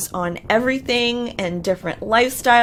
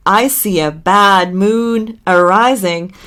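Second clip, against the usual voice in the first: slow, louder mock pronouncement; slow, weighty quote; 6 dB louder than usual. Husband making a joke that does not land, slow and loud. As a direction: draw the quote out slowly and louder. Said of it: "He then says".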